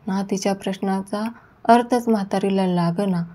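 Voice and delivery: ordinary delivery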